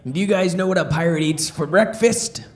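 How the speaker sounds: ordinary talk, nothing marked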